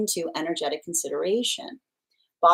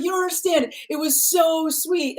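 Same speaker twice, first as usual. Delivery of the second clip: whiny client; 10 semitones higher than usual. Retold or imitated. Client pleading about sweet choice.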